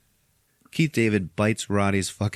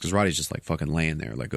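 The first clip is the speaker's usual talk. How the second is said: ordinary talk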